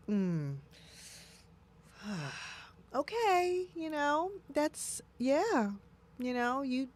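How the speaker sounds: high, soft, hesitant, with a sigh; forced polite acceptance. Retold or imitated. Humoring an outer-circle friend, high, soft, hesitant. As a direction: sigh and swallow your real opinion. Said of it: "I have to go".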